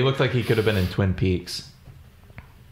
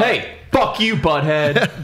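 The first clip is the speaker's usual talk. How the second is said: mocking voice; 6 semitones higher, slower than usual. Echoing quote in character voice.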